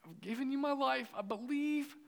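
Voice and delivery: high, earnest plea in prayer; crying out to God. Retold or imitated. Young believer praying to God over the bay, high pleading. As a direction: plead upward without raising the volume. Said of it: "I was crying out to God".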